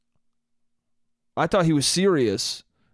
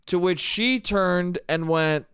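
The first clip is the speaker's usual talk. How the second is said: ordinary talk, nothing marked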